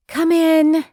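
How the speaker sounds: loud, high call toward the door; yelled out. A narrator's reading. Grace yelling to the knocker at the door, loud call. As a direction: call out over the cooking, eyes on the food. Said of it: "she yelled out".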